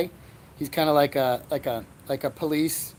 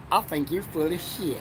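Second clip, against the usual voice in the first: character catchphrase; 4 dB quieter than usual. Acted. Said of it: "that's Rusty Ratliff's catchphrase".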